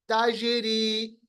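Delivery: slow ताजीरी, bouncing on ज; qalqalah demo. Shown as a demonstration. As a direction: echo the ज inside ताजीरी. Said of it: "देखिए कैसे मैं अदा कर रहा हूँ". (धीमा ताजीरी, जीम का क़लक़ला)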